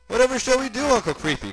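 put-on very high, loud child character; frightened kid. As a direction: wail it like a scared little boy. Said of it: "shaking in terror".

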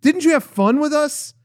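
imitating the room robot saying goodnight; sugary robot assistant. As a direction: coo at the guest like an eager AI. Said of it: "she was like".

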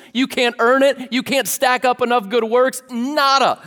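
full-force preaching. Acted. Pastor driving the gospel home to his congregation, preaching at full force. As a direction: preach it with rising fire.

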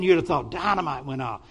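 usual speaking voice

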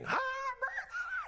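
very high, cracking shouted screech; a man with no volume control bellowing the hymn; imitated scream. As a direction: scream it out at full volume off key. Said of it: "he'll be screaming".

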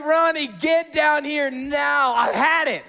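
high, loud fed-up mother; mock yell up the stairs at the kids; imitated mom yelling. Mom yells upstairs at fighting sons, imitated exasperation. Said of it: "my mom yells upstairs".

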